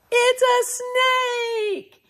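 excited shout. Acted. Host revealing the snake to children with mock alarm. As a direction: call it out like a surprise.

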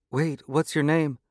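high, eager woman's call; young woman. A narrator's reading. Woman calls after the leaving hero, eager. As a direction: stop him before he goes.

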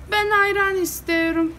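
high-pitched acted villager; villager calling out an order. Villager butting in to order ayran, calling out high.

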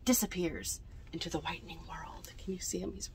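hushed storytime whisper; breathy bedtime whisper. A narrator's reading. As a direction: whisper it softly, as to sleepy children.

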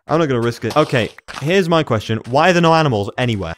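ordinary delivery

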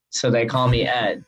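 ordinary talk, nothing marked

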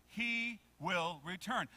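slow, firm, fuller voice. Shown as a demonstration. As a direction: project it word by word. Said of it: "A little bit louder".

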